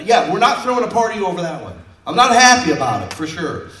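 loud, high insistence; emphatic loud. Real, not acted. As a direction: drive the point home loudly. Preacher insists on anger.

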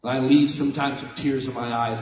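usual speaking voice

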